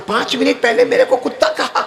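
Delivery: high-pitched, indignant outburst; acted anger. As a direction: voice the hakim's mounting outrage. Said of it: "ابو علی سینا کو بہت غصہ آیا".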